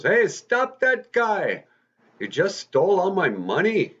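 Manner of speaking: acted high, loud shout of a robbed man; calling out. Robbery victim calls out for help.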